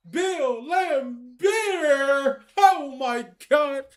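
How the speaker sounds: loud, drawn-out shocked yell at the pull; excited yell. Real, not acted. Gamer yelling at his pack pull, excited. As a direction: shout the name out in disbelief.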